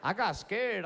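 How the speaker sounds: normal talking voice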